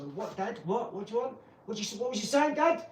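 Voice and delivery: put-on high, innocent kid voice, rising into the last Dad; little daughter. Daughter answering her dad, high innocent child voice. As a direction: play the cheeky daughter acting innocent.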